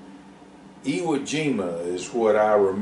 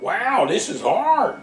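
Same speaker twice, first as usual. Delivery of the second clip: straining mule; 10 semitones higher, slower than usual. A narrator's reading.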